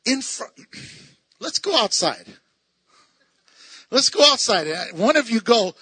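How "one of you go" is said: ordinary talk, nothing marked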